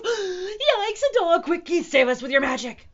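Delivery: high, loud alarm; frantic cats; panicked squeal. A narrator's reading. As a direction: squeal for rescue in a rush. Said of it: "The cat squealed in panic".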